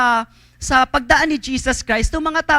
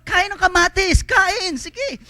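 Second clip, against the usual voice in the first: high, coaxing urging to eat; urging host; 8 semitones higher, slower than usual. Retold or imitated. Community leader urging the guests, coaxing and pitched high. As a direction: press them kindly to eat up. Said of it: "Sabi nung".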